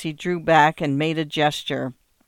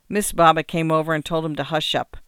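ordinary delivery, nothing marked

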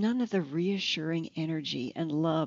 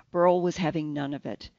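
ordinary delivery, nothing marked

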